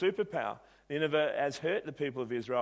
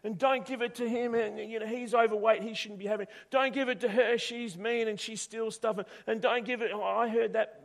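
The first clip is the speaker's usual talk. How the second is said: high, frantic yelling at the shopkeeper; jealous boy screaming at the shop owner to stop; 8 semitones higher, 4 dB louder than usual. Acted. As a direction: shout him down, pitch climbing. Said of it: "He's screaming at the shop owner".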